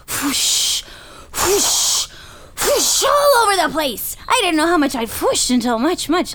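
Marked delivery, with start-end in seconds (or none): 0.1-3.0 breath-blown whooshing noises; flamethrower whoosh. Retold or imitated. Rider recalling motorcycle flamethrowers, imitated whooshes. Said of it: "drove around and was like".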